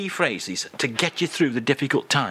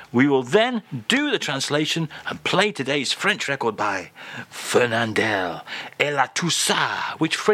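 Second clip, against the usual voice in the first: ordinary talk, nothing marked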